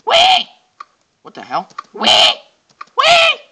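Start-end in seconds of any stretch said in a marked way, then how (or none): 0.1-0.5 high, piercing piggy wee; loud, playful squeals. Pretend. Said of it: "I sound like a pig". 1.9-3.4 high, piercing piggy wee; loud, playful squeals. Pretend. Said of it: "I sound like a pig".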